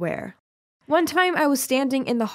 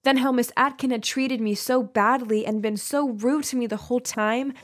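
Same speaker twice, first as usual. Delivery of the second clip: ordinary delivery, nothing marked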